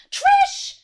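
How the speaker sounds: high, loud yelp of a name; sharp call. Acted. Host calling out to misbehaving Trish. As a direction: snap the name out.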